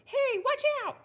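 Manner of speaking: very high, loud squawk; little rock yelling a high-pitched warning; hollering. A narrator's reading. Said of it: "the rock hollered".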